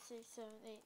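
ordinary delivery

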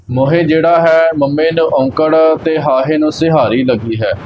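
ordinary talk

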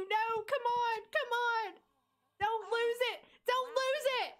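high, urgent cheering-on; urgent pleading. Real, not acted. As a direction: plead with them at a high, insistent pitch. Urging characters not to lose moment.

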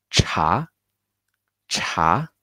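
第二声 茶; rising tone. Shown as a demonstration. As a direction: lift cha up like a question. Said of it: "start lower and then you make a rise".

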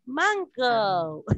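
"Mango" in two clear English syllables, falling; English pronunciation. Shown as a demonstration. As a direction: draw out the final o of "Mango" like English "go". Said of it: "pero se pronuncia así, miren".

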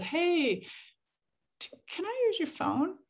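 high, laughing timid ask; high sheepish request with a laugh. Retold or imitated. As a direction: sheepishly ask to borrow the phone, giggling.

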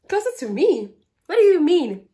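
surprised. Retold or imitated. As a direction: sound baffled by what he said. Confused reply to his remark.

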